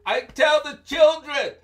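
loud, high insistence; insisting shout. Acted. Anansi insisting to the Sky King, loud shout. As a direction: shout it back firmly.

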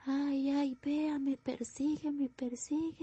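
put-on high, terrified child's plea; high-pitched panicked child; frightened little girl. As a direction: beg like a scared little girl. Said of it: "y empezó, pues, es que".

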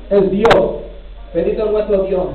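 ordinary delivery

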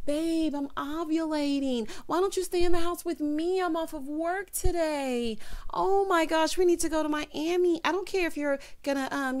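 high-pitched whining imitation; mock self-centred girlfriend. Mimicking selfish girlfriend nagging her man, mock pleading. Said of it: "Brittany is so selfish in her thought process".

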